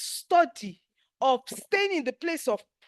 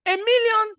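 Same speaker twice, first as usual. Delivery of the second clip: "A million" much higher and louder; emphatic preaching shout; 9 semitones higher, 7 dB louder than usual. Real, not acted. Urging viewers to ask big, shouting it out. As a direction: hit "A million" hard. Preaching against asking small.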